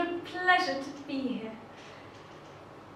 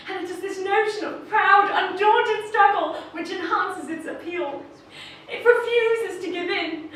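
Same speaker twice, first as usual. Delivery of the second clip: impassioned, higher and louder, building to a shout; rousing climax; 5 semitones higher, 9 dB louder than usual. Acted. Speaker rallies fellow cactus lovers, building to a triumphant shout.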